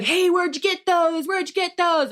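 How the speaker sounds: high nosy stranger; high mock voice. Shopper asking her where she found wipes, high mock voice.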